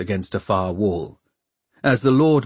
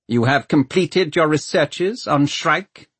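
raised, measured official voice; commanding, higher-pitched inquiry; questioning lord mayor; 7 semitones higher than usual. A narrator's reading. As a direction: question a subordinate formally. Lord mayor questioning his scientist.

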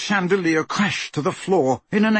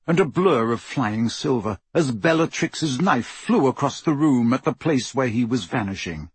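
plain reading voice

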